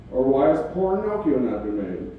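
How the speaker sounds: usual speaking voice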